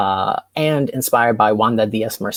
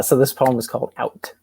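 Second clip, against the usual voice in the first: usual speaking voice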